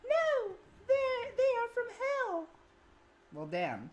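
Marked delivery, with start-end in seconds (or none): 0.0-2.5 high mock-tearful falsetto; dramatic high voice. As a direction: wail it melodramatically. Joke dub of character.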